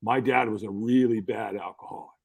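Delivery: low, understated retold admission; imitating a downcast son, low and restrained; subdued confession. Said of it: "he kinda put his head down".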